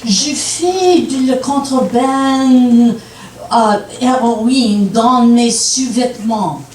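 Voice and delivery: slow, drawn-out French dialect showpiece; stagey French, slowly delivered. Shown as a demonstration. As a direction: show off a French accent, lingering on the phrases. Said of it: "my favorite is French".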